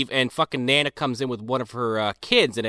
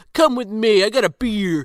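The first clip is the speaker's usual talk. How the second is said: sleazy bully; 8 semitones higher, 4 dB louder than usual. Retold or imitated. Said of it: "He's like".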